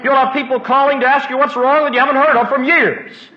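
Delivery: high, loud pulpit delivery; impassioned preacher in full cry; preaching at full force. Real, not acted. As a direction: belt it out to the congregation.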